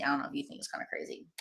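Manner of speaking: quick, hushed whisper; whispered aside. Real, not acted. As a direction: rush it under your breath. Repeats it as an aside.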